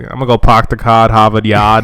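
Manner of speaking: put-on Boston accent. Coworker imitating Bostonians, hammed-up Boston accent. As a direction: exaggerate a Boston accent for laughs. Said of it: "the Boston accent's kinda funny".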